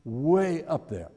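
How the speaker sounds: normal talking voice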